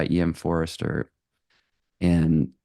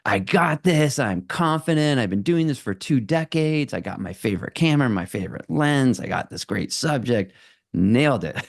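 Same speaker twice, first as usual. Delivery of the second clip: confident; 6 semitones higher than usual. Retold or imitated. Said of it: "it's not like".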